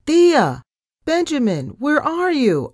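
high-pitched calling out for someone missing; worried yell. A narrator's reading. Narrator searching the ship for family, yelling. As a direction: yell to find them. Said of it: "I yelled".

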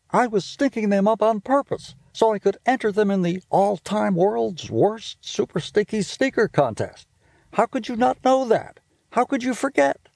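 high, upset little-brother voice; a kid outraged his plan is ruined; Stink's voice. Acted. Little brother scolding sister over ruined sneakers, high and upset.

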